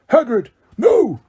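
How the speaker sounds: high, slow stopping cry; a commanding cry to stop; shout. A narrator's reading. Dumbledore stops Hagrid, high urgent shout. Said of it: "Dumbledore shouted".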